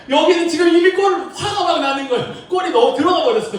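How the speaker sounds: high, loud exasperated laughing rant; frustrated excitement. Retold or imitated. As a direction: vent that the goal already went in. Reliving late broadcast frustration. (높고 크게 웃으며 답답해하는 흥분)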